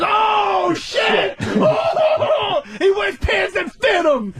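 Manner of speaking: mock crowd hooting. Everybody hooting at fitted pants, high laughing shouts. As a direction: roar like the whole crowd is howling. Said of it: "And everybody goes".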